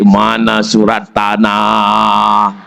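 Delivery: low, very slow and sly; sly greedy whisper. Retold or imitated. Wife slyly asking her dying husband for the land deeds. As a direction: lean in and ask slyly.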